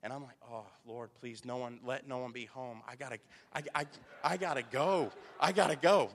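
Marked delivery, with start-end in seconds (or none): 0.5-3.6 low, quiet retold prayer; muttered plea. Dad privately begs the Lord nobody is home, muttered plea. 3.7-6.1 retold plea building to loud; urgent plea. Rushed dad pleading to get home, urgent plea.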